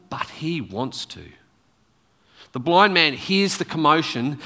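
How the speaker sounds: ordinary talk, nothing marked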